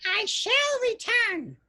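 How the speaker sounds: very high, slow screech; screeching urchin. A narrator's reading. Urchin promising Scrooge, high slow screech. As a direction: screech it like a street kid. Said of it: "screeched the adolescent in an uneducated accent".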